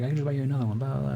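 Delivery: half-hearted snooty brag; mock flaunting. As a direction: lightly mimic her showing off. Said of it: "flaunting, like, wealth".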